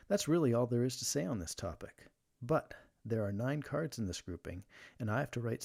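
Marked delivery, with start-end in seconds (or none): none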